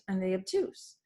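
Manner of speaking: usual speaking voice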